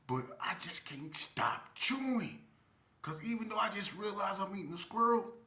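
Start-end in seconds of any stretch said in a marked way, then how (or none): 0.1-2.4 whispering. Real, not acted. Whispered confession.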